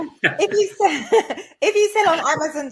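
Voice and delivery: giggling while trying to get the answer out; laughing. Real, not acted. [name] laughs after dodging question.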